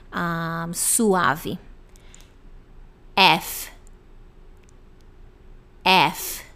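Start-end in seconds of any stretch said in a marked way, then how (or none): 3.2-6.4 "F" with a strong eh, then a gentle f; accented E, soft F. Shown as a demonstration. Teacher drilling English F, stressed start and soft ending. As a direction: say "F" front-stressed, tapering into soft air. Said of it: "o F fica bem mais".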